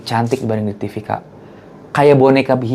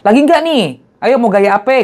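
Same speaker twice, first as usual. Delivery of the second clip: put-on much higher, louder Betawi-accented offer; 9 semitones higher, 3 dB louder than usual. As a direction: ask in a lively Betawi lilt. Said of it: "dengan logat Betawinya dia".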